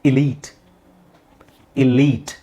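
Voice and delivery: deliberate Elite as i-LEET; teacher's careful Elite, stretched on "leet"; model pronunciation. Shown as a demonstration. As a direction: hold the long ee in Elite, crisp final t. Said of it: "how do you pronounce this".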